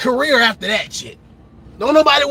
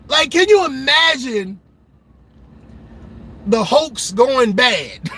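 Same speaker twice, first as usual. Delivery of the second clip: ordinary delivery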